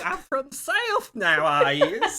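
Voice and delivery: high, loud, slow hammed-up Southern drawl; goofy put-on Southern voice, overdone; mock Southern accent. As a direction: lay on a silly Southern accent. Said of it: "I don't know what that accent was".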